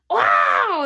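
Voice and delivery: loud, high electronic "wow" wail; imitated game-over toy scream; Bop It losing noise. Imitating the Bop It when you lose, loud wail. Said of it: "that wow noise".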